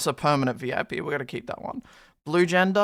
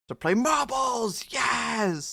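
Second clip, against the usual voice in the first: hyped announcement; 5 semitones higher, much slower than usual. Acted. Hyping the marbles event.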